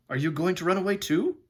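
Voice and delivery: a hint of a boy's wondering question. Boy asking the horse about escape, lightly wondering.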